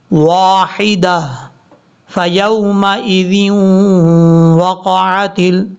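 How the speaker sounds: drawn-out chanted tajweed reading; slow Quran recitation. Shown as a demonstration. Teacher reciting verse slowly for students. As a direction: recite slowly, stretching each sound.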